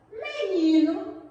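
menino drawn out with stress on "ni"; ênfase na sílaba tônica. Shown as a demonstration. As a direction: stretch menino, leaning on its middle syllable "ni". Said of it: "elas possuem a sílaba mais forte". (menino devagar, com força no "ni")

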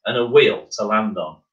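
plain reading voice

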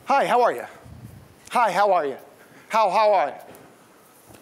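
breezy, high, hammed-up passing greeting; mock the quick brush-off greeting; tossing off a greeting while walking past; rushed hi how are you. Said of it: "We do this".

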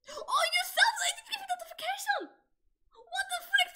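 high, loud surprised squeal; excited squeal. Real, not acted. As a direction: shriek with delight at the sub. Surprise at new subscriber.

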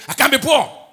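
loud, high-pitched cry; defiant outburst. Acted. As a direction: burst out with it at full voice. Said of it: "Don't say".

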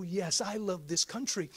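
ordinary delivery

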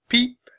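a tiny high penguin peep. A narrator's reading. Baby penguin Penny peeping, high.

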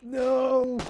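anguished shout. Acted. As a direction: scream no, high and loud. Friend dies in game.

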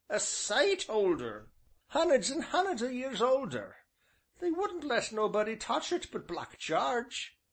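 put-on high old countryman's voice; old rustic innkeeper. A narrator's reading. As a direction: voice the old villager, folksy and drawn. Ancient's dialect voice.

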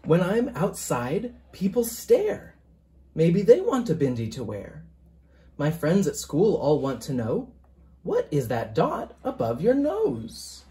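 gentle picture-book reading, even and measured; story narration. A narrator's reading. Author reading his picture book to listeners, storytelling voice. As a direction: read it aloud to a class of children.

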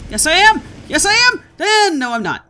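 high, very loud burst of hope; real excited shouting. Real, not acted. Player thinks the jump will work, excited yelling.

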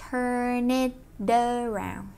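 "Turn it around" said slowly, each sound linked into the next; slow linked demo. Shown as a demonstration. Teacher slowly modelling the linking in "Turn it around" for students. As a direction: stretch "Turn it around" out slowly so the words run together. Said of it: "Vậy hai nối âm ở đây".

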